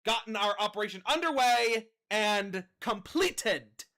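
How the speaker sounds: loud, slow, menacing command to her troops; rally the crew with cold contempt; villain commander speech. Acted.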